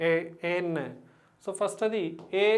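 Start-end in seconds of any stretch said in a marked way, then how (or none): 0.1-0.8 "AN" sounded out with a lingering n; slow pronunciation. Shown as a demonstration. Teacher repeating how the letter begins, drawn out. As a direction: separate "ఏ" from a held "ఎన్". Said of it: "ఇలా వస్తుంది".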